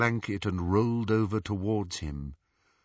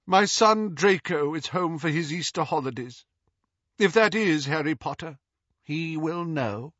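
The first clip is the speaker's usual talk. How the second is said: cold haughty lady; 6 semitones higher than usual. A narrator's reading. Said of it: "said Narcissa".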